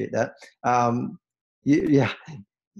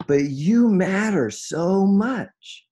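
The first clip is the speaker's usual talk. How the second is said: slow, heartfelt and a bit higher; sincere and tender; 7 semitones higher, 3 dB louder, much slower than usual. Real, not acted. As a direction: linger on each word so they feel it. Tender address to listeners.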